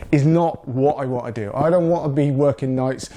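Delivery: usual speaking voice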